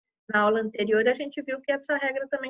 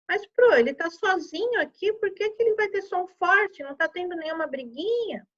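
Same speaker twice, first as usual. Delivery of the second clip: higher-pitched questioning pupil; mock pupil's query, pitched up; puzzled pupil; 6 semitones higher than usual. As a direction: pipe up like a pupil querying the teacher. Teacher voices a puzzled pupil's question.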